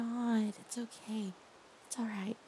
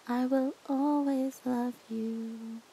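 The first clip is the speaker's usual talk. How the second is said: slow devotion; 6 semitones higher, 5 dB quieter, slower than usual. Shown as a demonstration. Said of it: "repeats the line in new ways".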